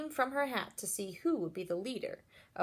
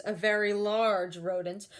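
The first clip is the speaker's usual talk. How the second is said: plain reading voice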